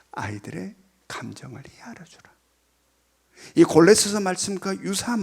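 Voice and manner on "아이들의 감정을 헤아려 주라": soft whispered restatement; whispered verse. Shown as a demonstration. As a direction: whisper the command gently. (속삭이며 말씀을 전하듯)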